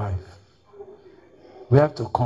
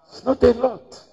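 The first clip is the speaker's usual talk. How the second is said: "It's not a lot." raised high and loud; emphatic; 9 semitones higher, 4 dB louder than usual. Shown as a demonstration. Preacher repeating his refrain to the congregation, emphatic high stress. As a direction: punch it out for the crowd. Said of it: "Are we together?".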